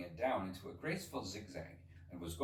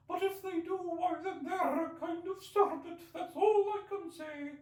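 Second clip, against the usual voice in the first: lamenting pigeon; 6 dB louder than usual. Acted. Pigeon ignoring Alice, fretful and aggrieved. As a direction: complain on and on like a fussy bird.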